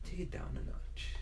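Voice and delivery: whispered teacher hush; hushed warning. Retold or imitated. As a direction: hush them like a teacher nearby. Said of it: "Mister G's like".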